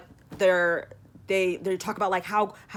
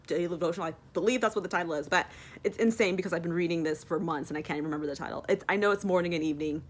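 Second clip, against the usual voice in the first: ordinary talk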